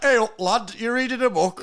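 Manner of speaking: high, loud northern caricature; mock northern accent. Mocking how you'd approach a lass, loud northern accent. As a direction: shout it like a cartoon northerner. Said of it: "that's what northern people say".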